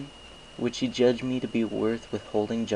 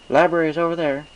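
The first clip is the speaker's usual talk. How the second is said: Applejack's voice; 8 semitones higher, 8 dB louder than usual. Acted. Applejack points out the library.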